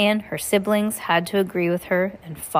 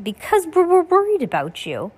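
put-on higher, baby-like voice; baby Sunny voice; 10 semitones higher, 4 dB louder than usual. A narrator's reading. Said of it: "which meant".

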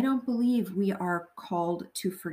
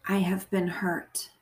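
guided meditation prayer. Acted. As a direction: pray phrase by phrase, leaving room to repeat.

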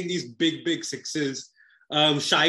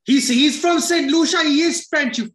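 indignant outburst; 11 semitones higher, 6 dB louder than usual. Real, not acted. Co-host arguing about a Saint Lucian accent, indignant shouting. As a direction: blurt it out loud and high, incredulous.

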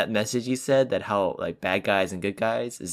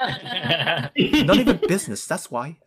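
higher voice with a laugh; Rey answering; 7 semitones higher than usual. Acted. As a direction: snap back at the nosy pilot. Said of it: "Okay, I got one".

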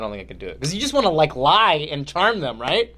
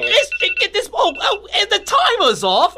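very high, hammed-up British lady voice; Missus Doubtfire; 5 dB louder than usual. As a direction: squawk excitedly in a cartoonish British falsetto. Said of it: "I just turned into Missus Doubtfire".

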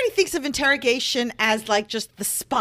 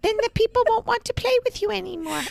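squeaky high voice; 9 semitones higher than usual. Acted. Squeaky voice continues, laughing.

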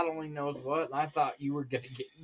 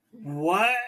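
loud, indignant challenge; retold fired-up; 3 semitones higher, 7 dB louder than usual. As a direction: imitate a riled-up biker. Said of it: "he got all fired up".

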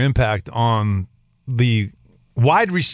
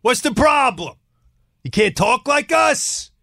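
mocking relative; 8 semitones higher than usual. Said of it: "They talk to your dad".

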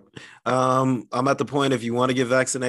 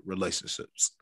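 normal talking voice

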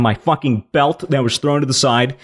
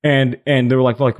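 normal talking voice

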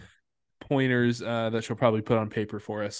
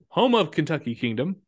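ordinary talk

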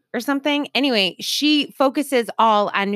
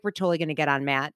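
fast quote; 5 semitones lower, 4 dB quieter, much faster than usual. Retold or imitated. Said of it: "She's like".

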